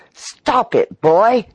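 high, loud cry of alarm. A narrator's reading. Lobbyist crying out in alarm at the runaway elevator. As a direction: shout it in sudden panic. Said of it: "he cried in alarm".

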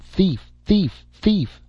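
high, ringing cry of thief, thief, thief; calling out thief. A narrator's reading. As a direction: call thief at the top of your lungs like an outraged rose. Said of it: "at the top of her voice".

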